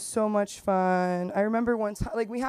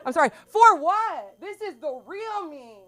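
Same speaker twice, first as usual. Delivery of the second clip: mock indignation; 7 semitones higher, 5 dB louder than usual. Mocking customers' pity.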